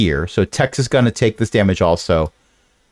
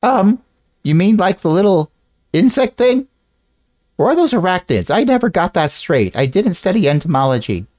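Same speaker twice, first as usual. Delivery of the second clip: character voice; 7 semitones higher than usual. Acted.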